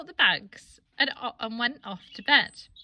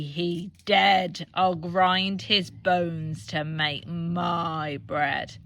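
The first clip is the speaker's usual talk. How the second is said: slow, low booming giant voice; slow giant chant, low and heavy; giant roaring; 4 semitones lower, much slower than usual. Acted. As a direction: boom each word out like a giant. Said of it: "the giant came home and roared".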